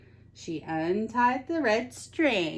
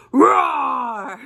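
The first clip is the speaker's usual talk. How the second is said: louder, higher mock roar; playful monster roar; 6 semitones higher, 8 dB louder than usual. Teacher to the children, a playful monster roar.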